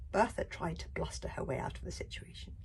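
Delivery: plain reading voice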